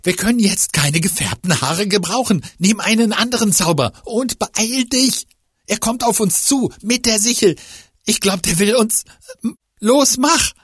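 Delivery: hoch und laut drängen; gehetzt drängend. Acted. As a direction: ungeduldig zur Eile treiben. Fiete drängt gestresst. (gehetzt drängend)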